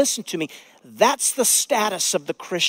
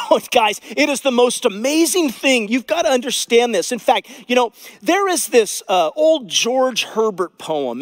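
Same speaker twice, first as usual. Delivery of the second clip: high, loud enthusiastic appeal; raised pulpit excitement; excited preaching; 6 semitones higher, 5 dB louder than usual. Acted. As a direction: urge the congregation, bursting with it.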